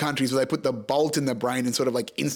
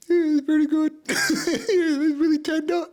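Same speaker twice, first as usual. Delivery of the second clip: put-on very high, sheepish little-boy voice; kid's inner voice; 12 semitones higher, 3 dB louder than usual. Boy tasting the meat in his head, high sheepish voice. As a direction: admit it like a guilty child enjoying it. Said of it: "I remember going in my head".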